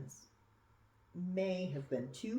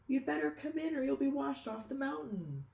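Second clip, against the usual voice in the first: a hint of a kind baker, pitched higher; lightly voiced baker; 10 semitones higher, 4 dB louder than usual. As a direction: lightly voice the baker calling him in. Baker calls dragon in from storm.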